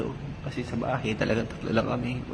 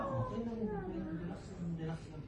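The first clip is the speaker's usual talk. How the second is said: soothing calm; 13 dB quieter than usual. Real, not acted. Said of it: "soothing the pretend pain".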